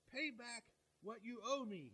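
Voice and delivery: slow, a touch of a harsh demand; mild demand. Acted. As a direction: lightly press him to pay, slowly. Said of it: "he demanded".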